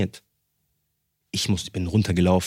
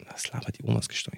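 sleepy disbelief; 11 dB quieter, faster than usual. Retold or imitated. Father half-asleep, disbelieving.

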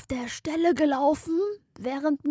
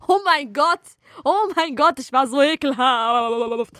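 embarrassed outburst; 3 semitones higher, 6 dB louder than usual. Real, not acted. Embarrassed outburst at own behaviour.

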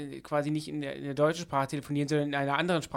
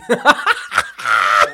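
loud laughing burst; laughing hard at his own joke; real laugh; 6 semitones lower, 13 dB louder than usual. Real, not acted. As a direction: break down laughing.

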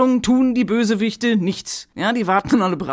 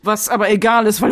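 ordinary talk

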